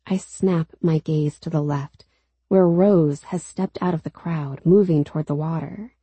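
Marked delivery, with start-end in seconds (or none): none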